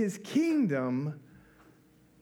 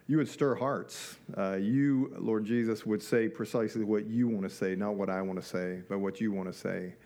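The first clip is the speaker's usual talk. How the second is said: low, even praying voice; subdued congregational prayer in a low, level pitch; 8 semitones lower than usual. Real, not acted. Opening prayer.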